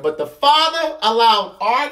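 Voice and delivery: ordinary delivery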